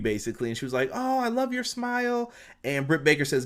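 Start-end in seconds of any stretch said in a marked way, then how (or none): 0.9-2.3 high, swooning fangirl voice; groupie gush. Retold or imitated. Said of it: "She turned into, like, a groupie".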